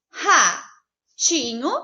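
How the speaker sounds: Ratinho said very slowly, split into two parts; syllable-split ratinho. Shown as a demonstration. Teacher correcting the exercise, splitting ratinho very slowly. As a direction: drag out Ratinho with a clear pause. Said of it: "E o ratinho?".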